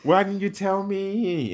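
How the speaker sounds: high, drawn-out mock wail; hammed-up dramatizing. Retelling Sarah's complaint to an amused co-host, hammed-up wail. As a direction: stretch out the betrayed friend's plea theatrically. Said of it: "I'm just dramatizing".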